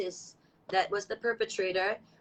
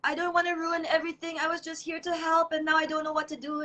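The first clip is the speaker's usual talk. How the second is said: put-on higher-pitched, upset quote of a crying girl; retold tearful plea; 6 semitones higher than usual. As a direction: imitate her tearful, overwhelmed pleading. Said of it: "she just start crying. She say".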